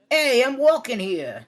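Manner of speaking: big, loud, high Brooklyn accent; Karen. Shown as a demonstration. Friend demonstrates how Karen talks, loud Brooklyn accent. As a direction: belt it out slowly in brash Brooklyn. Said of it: "kind of like how she does it".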